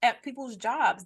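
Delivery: ordinary talk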